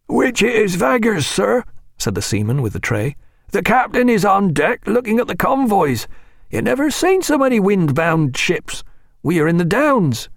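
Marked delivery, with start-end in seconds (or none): 0.1-1.6 put-on higher, rough old seaman voice; a salty old sailor, gruff. A narrator's reading. Seaman with the tray speaks. 3.5-10.3 put-on higher, rough old seaman voice; a salty old sailor, gruff. A narrator's reading. Seaman with the tray speaks.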